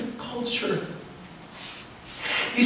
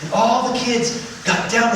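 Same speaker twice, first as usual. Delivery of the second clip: usual speaking voice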